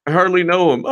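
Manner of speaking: hammed-up shifty denial; mocking Comey. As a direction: squeak out a fake innocent denial. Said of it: "the garden gnome".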